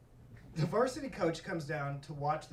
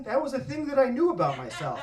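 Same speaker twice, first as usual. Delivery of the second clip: ordinary talk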